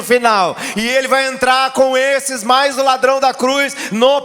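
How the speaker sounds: loud, high, slowed-down sermon shouting; fiery preaching. Real, not acted. Pastor preaching to congregation about hell, fiery shouting. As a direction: preach it with full force to the church.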